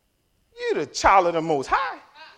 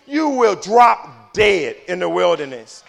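slow proclamation; 6 dB louder, slower than usual. Acted. God's verdict read slowly.

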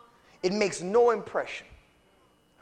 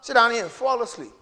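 ordinary talk

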